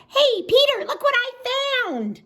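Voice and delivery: very high, loud bunny squeal; excited little bunny. Acted. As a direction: squeal it out with glee.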